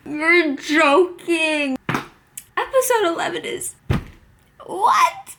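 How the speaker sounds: slow, loud, high disbelief; outraged disbelief. Real, not acted. Viewer shocked by the next episode, disbelieving. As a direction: protest it can't be true.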